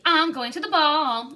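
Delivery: high pretend voice. A narrator's reading. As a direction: play a grand lady at a ball, slowly. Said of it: "in a high pretend voice".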